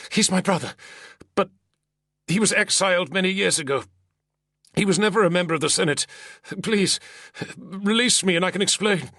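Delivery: high, hurried pleading senator; frightened pleading. Acted. Terrified senator pinned to the wall pleads with his attacker. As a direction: beg for release, words tumbling out.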